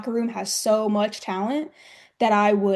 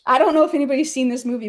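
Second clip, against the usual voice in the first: ordinary delivery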